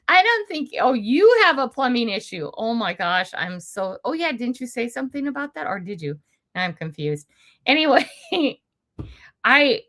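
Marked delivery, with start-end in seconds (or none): none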